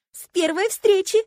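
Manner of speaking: high, loud, slow rapture; dreamy gushing. A narrator's reading. Roommate romanticising the first meeting, dreamily gushing. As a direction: draw it out like a starry-eyed romantic. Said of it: "мечтательно уставилась в потолок".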